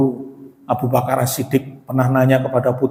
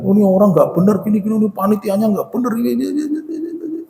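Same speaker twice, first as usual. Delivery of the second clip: mock complaining; 7 semitones higher, faster than usual. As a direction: grumble climbing ever higher and fading into babble. Imitates someone complaining about the committee.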